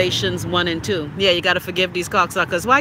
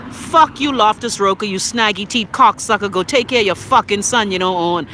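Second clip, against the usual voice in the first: raised, forceful tirade; angry cursing; 4 dB louder than usual. Real, not acted. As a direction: cuss out the absent minister loudly. Cursing out an absent minister.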